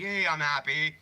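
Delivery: bright upbeat "Hey, I'm happy"; mock cheerful greeting. Mimicking the streamer's cheery hello, lightly. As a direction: parrot his chirpy catchphrase. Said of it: "It sounds so weird every time he's like".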